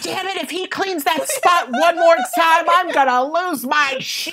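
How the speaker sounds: high mock-exasperated captain; high, fed-up inner voice; captain's frustrated thought. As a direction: vent like a fed-up boss.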